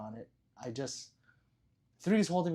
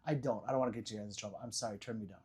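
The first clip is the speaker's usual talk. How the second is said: ordinary delivery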